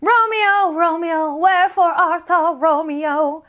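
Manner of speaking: theatrical, high, projected Juliet line; stagey recitation for an audience; acting in a play. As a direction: declaim it like a stage actor. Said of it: "or acting in a play".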